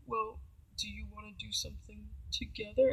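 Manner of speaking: imitating him, very softly; a hushed, put-on tentative offer; soft mock suggestion. Date proposing something together, soft mock hinting.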